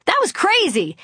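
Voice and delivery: high, thrilled exclamation; excited. Retold or imitated. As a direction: burst out with delight. Thrilled after singing live.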